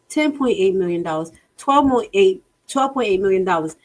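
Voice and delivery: usual speaking voice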